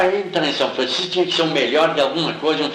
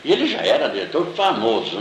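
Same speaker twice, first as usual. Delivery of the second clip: ordinary delivery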